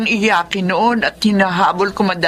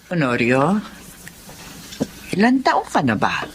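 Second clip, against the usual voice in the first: put-on old woman voice; 5 semitones lower, 4 dB quieter than usual. Elderly mother asks son.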